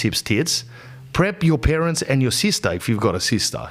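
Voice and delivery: ordinary delivery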